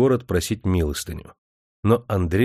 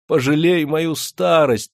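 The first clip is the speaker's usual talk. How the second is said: higher and slow, begging; wailing plea; 6 semitones higher, 3 dB louder, slower than usual. A narrator's reading. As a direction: beg on her knees. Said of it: "вопила старуха". (старуха вопит и молит)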